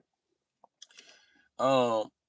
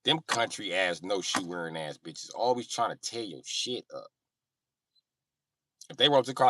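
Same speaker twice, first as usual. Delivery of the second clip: ordinary talk, nothing marked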